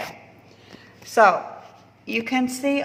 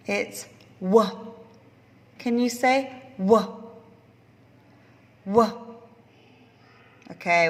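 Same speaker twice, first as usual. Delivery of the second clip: ordinary talk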